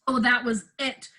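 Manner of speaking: usual speaking voice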